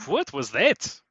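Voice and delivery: put-on Romanian accent; indignant NPC with a Romanian accent. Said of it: "He's Romanian suddenly".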